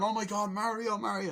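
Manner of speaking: high, excited crowd chant; gushing cries of the name; mock cheering. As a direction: imitate fans rushing the winner. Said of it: "they're all cheering".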